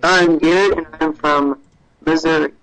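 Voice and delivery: loud, uneven caller audio; bad mic, volume jumping around; mic cutting out. Real, not acted. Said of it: "your mic is cutting in and out".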